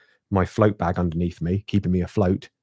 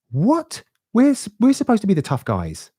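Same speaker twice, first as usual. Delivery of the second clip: high, incredulous "What?" then indignant; retold disbelief; 13 semitones higher, 4 dB louder, slower than usual. Telling friends about the swimming lady, mock outrage. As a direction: replay the shock of being out-toughed.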